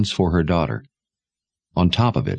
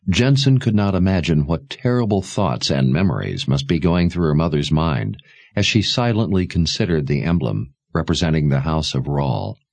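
plain reading voice

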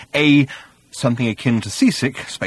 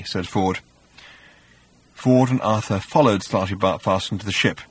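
ordinary delivery

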